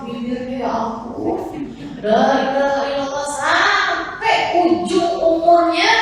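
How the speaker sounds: a dying man rambling, crying out la ilaha; loud strained dying chant broken by wandering thoughts; dying man raving. Retold or imitated.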